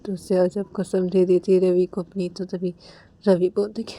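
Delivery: normal talking voice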